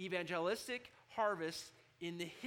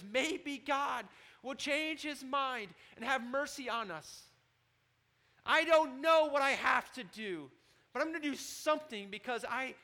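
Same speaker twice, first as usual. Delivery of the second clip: higher and louder, slow, desperate hope; the repentant king; 9 semitones higher, 8 dB louder than usual. Acted. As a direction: plead like a king who just believed. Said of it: "look what the king says".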